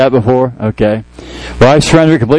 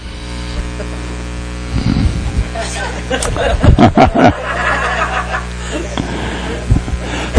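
long, airy unvoiced laugh; long soft laugh at the joke; long breathy laugh; 8 semitones higher, 7 dB quieter than usual. Real, not acted. Long laugh after skit.

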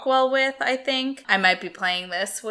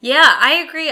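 excited; 7 semitones higher, 10 dB louder than usual. Real, not acted. Emphatic agreement.

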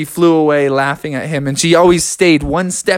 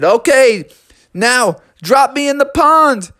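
raised, excited frog voice; eager call; 8 semitones higher, 3 dB louder than usual. Acted. Froggy asking Eddie to let go, high eager call. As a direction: call out the request high and keen.

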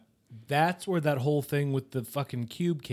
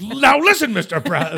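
very high, loud mock indignation; playful imitation of an outraged telling-off; mock scolding; 8 semitones higher, 11 dB louder than usual. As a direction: burst out in hammed-up protest. Mock scolding in retold banter.

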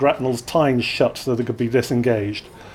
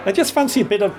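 tipsy flirting woman; 7 semitones higher, faster than usual. Acted. Drunk woman propositions.

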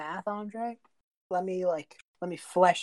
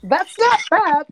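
excited; 12 semitones higher, 10 dB louder, slower than usual. Real, not acted. Excited reaction to damage.

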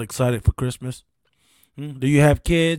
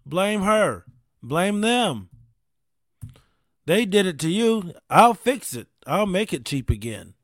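loud, high campaign-stump voice, pushy; mock politician; 4 semitones higher, 3 dB louder than usual. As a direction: ham up a politician promising fixes. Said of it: "every politician ran on it".

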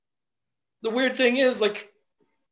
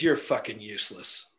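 cold contempt; 6 semitones lower, 6 dB quieter than usual. Retold or imitated. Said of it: "looks down at me and says".

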